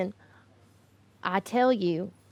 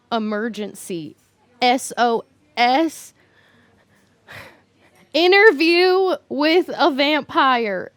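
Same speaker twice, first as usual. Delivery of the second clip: loud urgent reading; 4 semitones higher, 8 dB louder than usual. Acted. Texting an urgent movie SOS.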